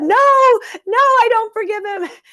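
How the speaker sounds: high, loud tearful refusal; crying protest. Retold or imitated. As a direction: push back through tears, voice going way up. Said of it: "And I'm crying".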